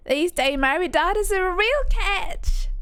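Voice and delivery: put-on high Southern belle accent. Belle praising married daughters, high Southern belle accent. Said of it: "their accent was just beautiful".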